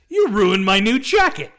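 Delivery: loud, high, campy villain outburst; villain impression. Host quoting the henchman to his vampire crew, loud and theatrical. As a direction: shout the order with exaggerated enunciation. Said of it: "like, this, the enunciation".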